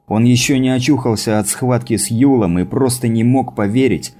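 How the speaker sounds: ordinary delivery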